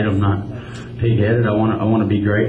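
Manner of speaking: ordinary talk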